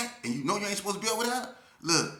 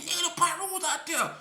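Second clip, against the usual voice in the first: urgent warning; 12 semitones higher than usual. Retold or imitated. Repeating the warning to Bread.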